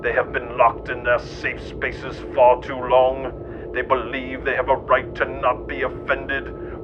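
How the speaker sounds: normal talking voice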